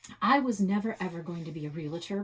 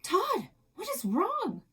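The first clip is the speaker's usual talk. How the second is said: worried mom; 13 semitones higher, 4 dB louder than usual. Acted. Mother rushes to dad.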